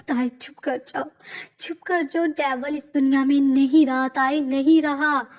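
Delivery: tearful insistence. Acted. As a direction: beg her to stop denying it. Friend insists he is dead.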